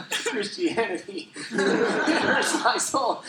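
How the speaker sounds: high, slow, laughing mock reverence; mock awe laughing. Seeing the Love Bears Bible cover, laughing mock awe. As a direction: gush in mock wonder. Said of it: "I was like".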